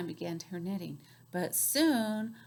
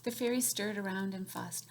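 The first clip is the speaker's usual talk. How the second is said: ordinary delivery, nothing marked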